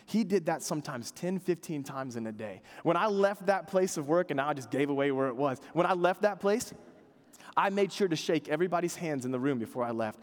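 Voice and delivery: normal talking voice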